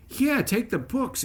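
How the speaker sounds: put-on high, slow, eager boy's voice; eager kid. Boy handing over the books, pitched high and eager. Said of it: "he said".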